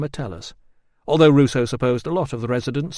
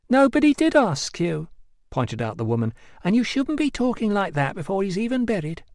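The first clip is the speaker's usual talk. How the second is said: higher, scolding local woman; higher chiding wife voice; barber's wife; 8 semitones higher than usual. A narrator's reading. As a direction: stick up for the dead man. Wife retorts.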